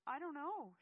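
loud, high exasperated outburst; frustrated yell. A narrator's reading. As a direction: yell it, out of answers. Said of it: "she yelled in frustration".

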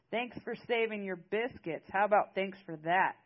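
loud, angry comeback, rising in force; storming mad. A narrator's reading. Maris demanding thanks from Britt, storming mad. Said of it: "Maris was storming mad".

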